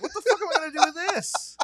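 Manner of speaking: a squeaky, laughing what-do-I-do-now; laughing mock panic. Telling his cohost about the Mexico weed brick, laughing panic.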